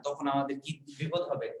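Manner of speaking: normal talking voice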